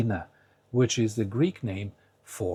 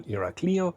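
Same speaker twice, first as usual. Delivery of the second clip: "Iraklio" with the I before the final O stressed, higher pitch; wrong stress demo; 7 semitones higher than usual. Shown as a demonstration. Teacher giving a wrong example, stress on the LI of "Iraklio". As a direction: move the stress onto the LI of "Iraklio". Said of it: "and not, for example".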